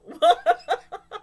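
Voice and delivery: a high, ringing laugh. Real, not acted. Laughing at her own retelling of the scene. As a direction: laugh it out loud.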